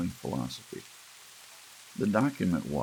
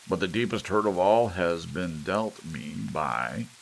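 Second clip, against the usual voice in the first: pompous grievance; 4 semitones higher, 6 dB louder than usual. A narrator's reading. Gramps dictating his grievance.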